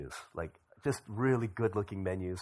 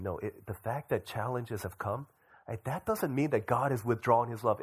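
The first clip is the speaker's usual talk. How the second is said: ordinary talk, nothing marked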